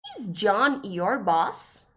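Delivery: slow, careful English example; slow model sentence. Shown as a demonstration. Teacher reads the English example slowly to students. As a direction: spell it out slowly for learners.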